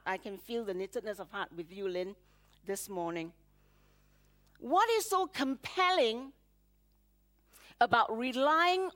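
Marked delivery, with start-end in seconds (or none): 0.1-3.3 soft tender aside. Real, not acted. Tender aside to worship leader.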